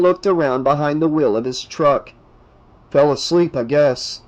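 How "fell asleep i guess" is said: plain reading voice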